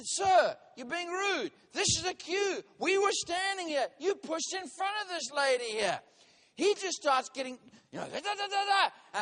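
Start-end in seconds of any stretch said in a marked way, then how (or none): 0.0-6.0 retold argument, high and loud; heated retort. Retold angry argument in a queue. 8.1-9.2 mimicked ranting. Imitates the man getting worked up.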